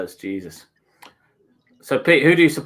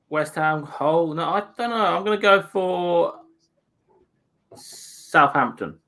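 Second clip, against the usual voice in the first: usual speaking voice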